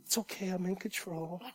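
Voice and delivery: soft, hushed reassuring God voice; hushed God comforting someone gently; gentle reassurance. Retold or imitated. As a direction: soothe a fragile soul. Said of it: "to somebody like, you know".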